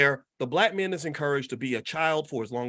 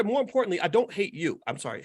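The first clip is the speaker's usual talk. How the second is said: ordinary delivery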